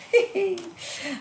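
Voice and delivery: a bright amused giggle; high real laugh. Real, not acted. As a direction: laugh at your own hamming. Laughs after spooky reading.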